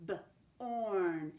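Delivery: "B-orn" split into a short buh, then a long held orn; sounded out. Shown as a demonstration. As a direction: sound out "B-orn" with a gap after the b. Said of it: "Let's sound that out".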